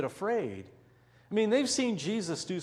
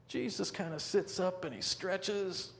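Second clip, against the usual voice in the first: ordinary talk, nothing marked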